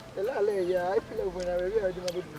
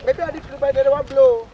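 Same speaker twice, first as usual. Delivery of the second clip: shouted, louder and higher; raised voice in an argument; loud outburst; 4 semitones higher, 12 dB louder than usual. Acted. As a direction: yell it across the room. Heated exchange between two.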